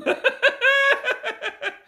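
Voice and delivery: laughing hard and very high; a real squeal of laughter at himself; high shrieking laugh. Real, not acted. Laughs at his own riddle.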